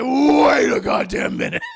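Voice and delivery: loud, stretched-out halt; outraged shout. Acted. Leanne stops the Buzzard woman's insinuation, shouting in outrage. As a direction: holler it with a long first word.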